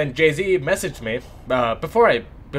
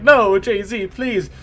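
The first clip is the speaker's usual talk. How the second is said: mock scared; 6 semitones higher, 5 dB louder, much slower than usual. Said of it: "And I got scared".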